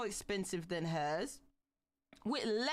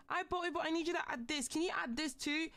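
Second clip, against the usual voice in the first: high, fast whiny mimic of a buyer; mock the demanding customer; mocking entitled buyer; 6 semitones higher, faster than usual. Streamer mimicking an entitled buyer to chat, mocking. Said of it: "the audacity of people to be like".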